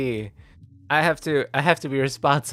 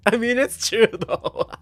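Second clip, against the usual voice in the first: high, loud, giggly voice; laughing; 11 semitones higher, 6 dB louder than usual. Real, not acted. Streamer conceding the joke to viewers, high and laughing. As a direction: squeak it out between real laughs.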